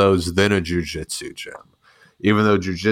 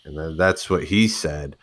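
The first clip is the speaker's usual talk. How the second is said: usual speaking voice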